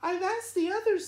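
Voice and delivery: high, light swallow voice; Lolita's voice. Acted. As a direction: pitch it up like a small bird. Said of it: "said Lolita".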